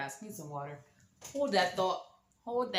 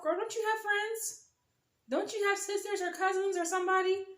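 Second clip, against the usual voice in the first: very high, incredulous questioning; exasperated inner thought; 12 semitones higher than usual. Retold or imitated. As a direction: squeal the silent plea up high. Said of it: "I'm thinking to myself".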